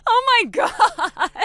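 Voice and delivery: high, loud unhinged cackle; shrieking villain laughter through the words; maniacal laugh. Acted. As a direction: laugh like she has snapped. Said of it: "this is the part where I laugh".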